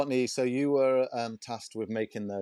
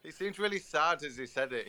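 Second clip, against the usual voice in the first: ordinary talk, nothing marked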